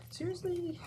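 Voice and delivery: high, soft disbelieving seriously; a stunned gasp of disbelief; shocked reaction. Retold or imitated. Teacher's silent reaction to her angry student, high and soft. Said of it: "I didn't say that to him".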